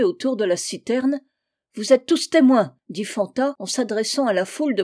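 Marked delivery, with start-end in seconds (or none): 1.7-2.8 loud public declaration; calling to a crowd. A narrator's reading. Fanta addresses the churchgoers at the well, calling out. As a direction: announce it to all.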